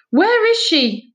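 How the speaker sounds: high, loud, slow frantic shout. Acted. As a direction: yell for her missing daughter. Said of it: "she was shouting".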